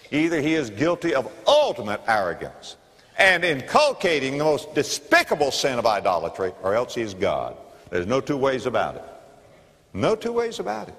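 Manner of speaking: ordinary delivery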